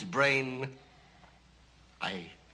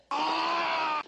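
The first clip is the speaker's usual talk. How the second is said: sudden shout; 8 semitones higher than usual. Acted. Scream before locked in.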